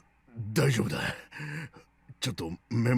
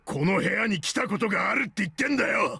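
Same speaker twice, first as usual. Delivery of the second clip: frustrated shout; 9 semitones higher than usual. Acted. Seven insists, frustrated.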